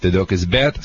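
usual speaking voice

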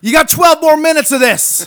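high, loud hammed-up warning voice; mock announcer. Friend jokes about the long rant, loud mock announcer. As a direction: announce it like a carnival barker.

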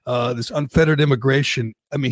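ordinary talk

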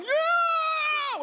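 put-on very high, loud, drawn-out kid's yell; little kid yell. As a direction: belt it like an excited nine-year-old. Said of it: "he's just kinda going".

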